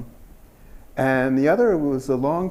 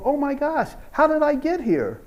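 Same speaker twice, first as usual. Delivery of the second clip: much higher, wide-eyed astonishment; amazed exclamation; 12 semitones higher than usual. Pretend. Imagined future student finding themselves somewhere, astonished high exclamation. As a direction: gasp it out, suddenly amazed.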